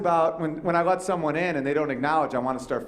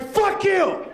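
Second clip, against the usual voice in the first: high yelled curse at honking drivers; mock shout; 3 dB louder than usual. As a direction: bark it back, hammed-up. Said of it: "I'm like".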